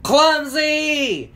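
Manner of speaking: high, loud, long call. A narrator's reading. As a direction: holler the name after someone far away. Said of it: "Hefty called out as they disappeared".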